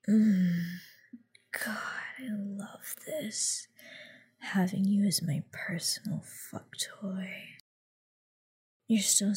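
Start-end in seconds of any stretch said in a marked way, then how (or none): 2.2-7.3 breathy close-mic whisper throughout. Acted. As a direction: whisper right into his ear, teasing. Held whisper.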